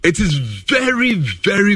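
slow, high "very, very"; stressed very. Real, not acted. Pundit stressing how "very" important it is. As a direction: lean hard on each "very". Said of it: "very important".

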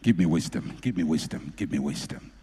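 a touch of a low, hushed plea to God; murmured prayer. Pretend. Preacher voicing an angry man's prayer, low hushed plea.

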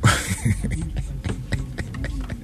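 low, quiet helpless chuckle; real laughter. Real, not acted. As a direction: trail off into a long soft laugh. Host cracks up mid-sentence.

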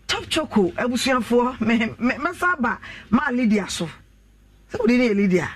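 upbeat radio sponsor read; host reading a sponsor ad on air, upbeat. Acted. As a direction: sell the chocolate drink to listeners. Reads sponsor ad.

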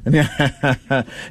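chuckling laugh; real laugh amid friendly football banter. Real, not acted. Amused during transfer fee argument.

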